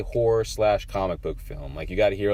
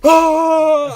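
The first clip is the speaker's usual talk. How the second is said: loud high "Oh!" of sudden excitement; excited yelp; 12 dB louder than usual. Retold or imitated. Friend recalling the movie reveal, loud high excited yelp. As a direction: yelp it like the big reveal just hit. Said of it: "I think I made a sound".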